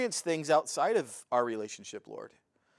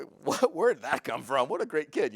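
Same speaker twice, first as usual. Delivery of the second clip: mock amazement; 3 dB louder than usual. Parent amazed by their child.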